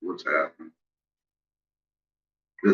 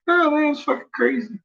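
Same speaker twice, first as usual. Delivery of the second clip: imitating a friend. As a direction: squeak it out like a freaked-out buddy. Friend's reply repeated.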